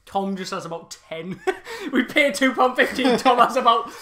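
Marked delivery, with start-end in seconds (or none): 1.4-3.9 laughing through the words; giggling as he talks. Real, not acted. Laughing while telling story.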